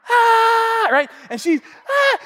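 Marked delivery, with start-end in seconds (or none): 0.1-0.9 high, loud mock shriek; imitated little-girl scream. 1.9-2.2 very high, loud mock shriek; short scream of a scared kid; imitated little-girl scream.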